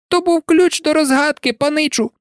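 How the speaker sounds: very high elf voice, surprised it is obvious; wide-eyed house-elf. A narrator's reading. Dobby explaining the clue to Harry, surprised. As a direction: explain as if it were plain. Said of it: "здивовано вирячивши очі".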